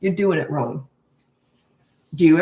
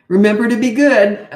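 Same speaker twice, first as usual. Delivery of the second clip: louder, preachy nagging reminder; patronizing reminder; 2 semitones higher, 8 dB louder than usual. Shown as a demonstration. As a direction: wag a finger at the kid. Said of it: "don't talk like that".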